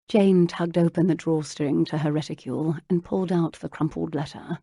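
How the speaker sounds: usual speaking voice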